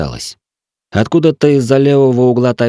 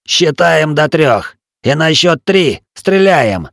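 slow, higher, deliberate villain; slow menacing count; 7 semitones higher, slower than usual. A narrator's reading. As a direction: spell out the rules slowly. Villain sets duel count.

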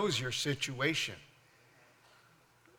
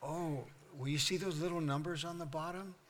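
playful answer; 7 dB quieter than usual. Retold or imitated. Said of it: "And I go".

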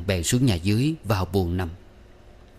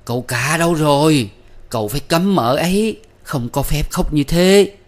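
high, loud furious shout; angry call; 7 semitones higher, 6 dB louder than usual. A narrator's reading. Mother-in-law angrily calls her son to stop the bride, loud. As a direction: call out in a rage. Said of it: "Bà Tuần giận quá gọi".

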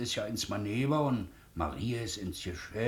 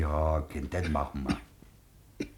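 beruhigend; 8 semitones lower, slower than usual. Acted. Onkel beruhigt das kranke Kind.

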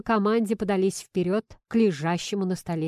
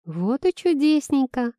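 higher, chirpy satisfaction; pleased; 6 semitones higher than usual. A narrator's reading. Friend seizes on the fiancé's words, pleased. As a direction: pounce on his answer gladly. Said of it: "обрадовалась Маринка".

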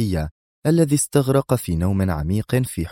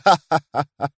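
high, taunting villain laugh; pitched-up derisive cackle; loud laugh; 7 semitones higher than usual. A narrator's reading. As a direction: let out a ringing mock laugh. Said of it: "أطلق القائد ضحكة عالية أخرى".

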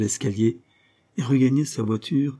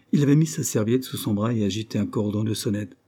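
ordinary delivery, nothing marked

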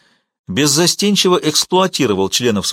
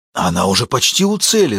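out of breath, urgent; breathless exclamation. A narrator's reading. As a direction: gasp it out excitedly. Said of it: "задыхаясь".

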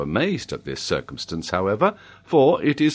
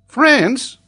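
loud, very high one-word question; astonished child; 8 dB louder than usual. A narrator's reading. Boy is baffled by the professor's correction, astonished high voice. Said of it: "with an astonished look".

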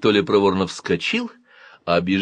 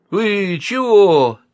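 high, drawn-out, dismayed squeak; thin voice; 11 semitones higher, 6 dB louder, much slower than usual. A narrator's reading. Boy facing a gun, thin high voice. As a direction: top pupil who just got a failing grade. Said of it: "тонким голосом отличника, схватившего двояк".

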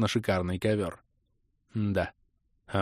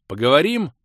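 higher, louder call to talk; raised voice; 5 semitones higher, 6 dB louder than usual. A narrator's reading. Leader addressing the crusader again, raised voice. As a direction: raise your voice to get attention. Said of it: "повысив голос".

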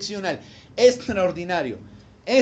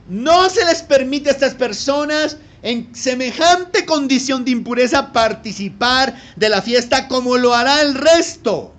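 higher, louder emphatic preaching; full-force preaching; 4 semitones higher, 7 dB louder than usual. Acted. Preacher pressing his points on the congregation, loud and emphatic. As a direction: drive each point home.

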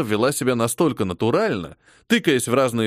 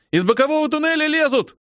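panicked yell; 13 semitones higher than usual. A narrator's reading. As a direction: scream the warning in panic. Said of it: "закричал один из новобранцев". (испуганный крик новобранца)

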